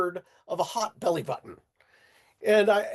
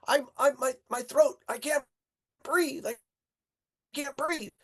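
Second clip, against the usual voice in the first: higher, strained gasping; choking friend; 7 semitones higher than usual. Retold or imitated. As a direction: squeeze it out like your throat is closing. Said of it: "he started choking".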